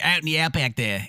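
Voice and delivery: mock outback Aussie twang. Shown as a demonstration. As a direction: ham up a backwoods Australian. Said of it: "the hick Australian".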